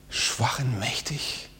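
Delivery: slow, soft whispered Bible quote; slow whispered scripture. Acted. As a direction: breathe the verse out slowly.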